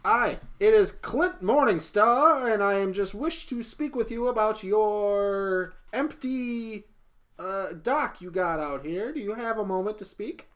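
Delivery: loud, high, drawn-out announcement; hailing in character. Acted. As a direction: call slowly and grandly through a door. Clint calls through the door.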